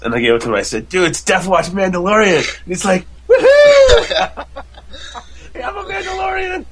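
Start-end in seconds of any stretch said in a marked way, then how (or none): 0.9-2.4 retold hype. Dad hypes costume to son. 3.3-4.5 very high, loud "woohoo"; mock childlike squeal of joy; imitated kid's whoop. Imitates son's joy at costume. 5.5-6.6 very high, softer mock kid voice; excited little son. Imitates son celebrating in costume.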